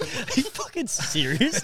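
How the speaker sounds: slow, high, laughing disbelief. Retold or imitated. Locked in with the alarm, asking his mate in laughing disbelief.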